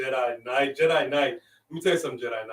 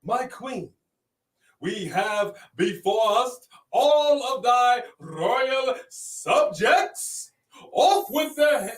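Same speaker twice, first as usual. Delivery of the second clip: put-on royal proclamation accent; 9 semitones higher, much slower than usual. Hailing the king and queen of YouTube, royal proclamation accent. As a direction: proclaim it like a hammed-up town crier. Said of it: "I know what this accent is".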